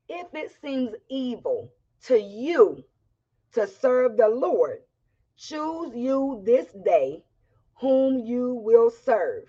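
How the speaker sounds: slow, weighty preaching. Acted. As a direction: lean on each phrase slowly. Slow emphatic restatement.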